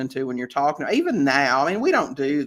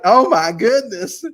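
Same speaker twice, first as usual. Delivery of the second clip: imitating a horrified gasp, higher, louder and slow; shocked seller; 5 semitones higher, 8 dB louder, slower than usual. Seller reacting to her rundown rental, imitated in mock horror. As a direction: drag it out like she can't believe it. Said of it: "And she's like".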